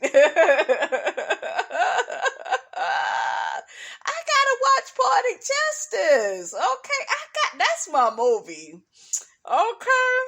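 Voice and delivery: high squealing laugh spilling into the words; excited laughing. Real, not acted. Telling viewers Poetic Justice is her movie, squealing with excitement. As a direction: gush about a favorite movie.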